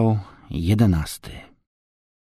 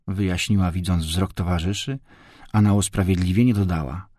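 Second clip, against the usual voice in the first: usual speaking voice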